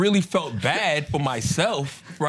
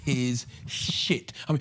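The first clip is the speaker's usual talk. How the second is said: ordinary delivery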